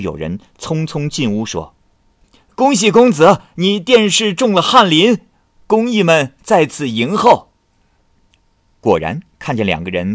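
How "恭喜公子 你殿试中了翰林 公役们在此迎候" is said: high, loud herald calling out good news; eager servant shouting the news; excited announcement. Acted. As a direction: burst in crying the good tidings. Officials announce Hanlin appointment.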